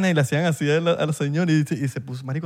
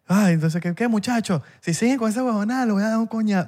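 high mock old lady scolding; 6 semitones higher than usual. Old lady threatening the crying family, higher mock scolding. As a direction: squeak out an old woman's threat, half-joking. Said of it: "la señora".

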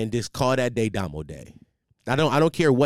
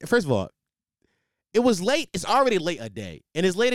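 1 exasperated; 8 semitones higher than usual. Real, not acted. Exclaimed complaint.

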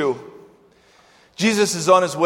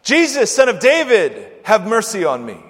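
raised, high-pitched plea falling away; crying out; 3 semitones higher, 6 dB louder than usual. Retold or imitated. Blind beggar calls to passing Jesus, crying out. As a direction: call out for mercy, loud and high at first. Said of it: "the man cried out".

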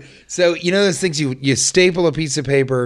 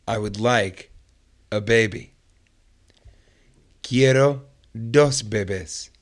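language tape read; 4 dB quieter than usual. Acted.

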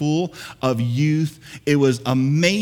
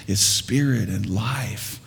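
slow, low whispered words; 7 semitones lower, slower than usual. Real, not acted. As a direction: breathe the verse out in a whisper. Hushed scripture line.

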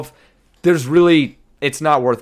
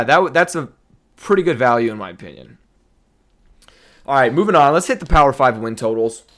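usual speaking voice

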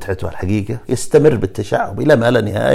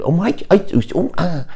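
mock Chinese; 9 semitones higher, much faster than usual. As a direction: chop out nonsense syllables in a high voice. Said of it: "يقعد ينتر كذا".

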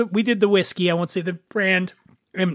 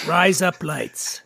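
slow "rise up lights" as Australian "razor blades"; unintentional Australian accent; 2 semitones lower, much slower than usual. Shown as a demonstration. Dan testing the trick phrase himself, unintentional Australian accent. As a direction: draw it out into an accidental Australian accent. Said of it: "you unintentionally sound, like, really Australian".

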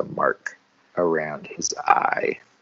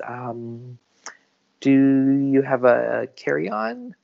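polite attendant voice; 6 semitones higher, 4 dB louder, slower than usual. Acted. Airport attendant voice.

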